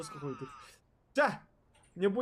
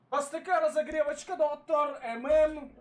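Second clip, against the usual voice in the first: announcer voice; 6 semitones higher, 6 dB louder than usual. Pretend. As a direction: announce it like a show host. Announcing warmup bootcamp.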